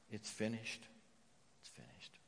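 a hushed echo; fading away. Real, not acted. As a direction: let the repeat die to almost nothing. Closing repeated phrase fading out.

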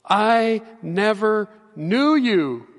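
drawn out, each word weighed; slow and solemn. Shown as a demonstration. Preacher repeating Jesus's verdict to the congregation, slow and grave. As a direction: let it land slowly and gravely. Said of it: "It's sobering and it's serious".

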